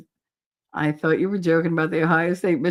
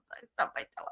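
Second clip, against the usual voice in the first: barely voiced, very soft; hushed cover story; 14 dB quieter, much faster than usual. Child covering up grandmother's pinch, hushed and strained. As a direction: squeeze it out under your breath.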